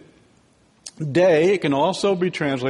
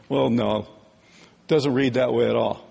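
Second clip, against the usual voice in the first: normal talking voice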